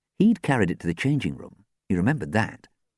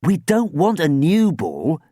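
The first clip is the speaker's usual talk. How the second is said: high, slow, insistent; raised-voice objection; exclaimed protest; 10 semitones higher, 5 dB louder, slower than usual. A narrator's reading. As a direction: protest loudly and emphatically. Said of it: "Dan exclaimed".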